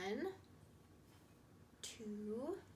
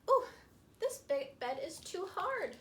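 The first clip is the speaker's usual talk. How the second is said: too hard; 5 semitones higher, 6 dB louder than usual. Acted. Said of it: "decided to try".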